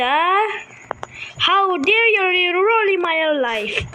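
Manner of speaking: high-pitched mock tantrum of a cheated child; whiny kid tantrum. (kunwaring nagmamaktol na bata, mataas ang boses)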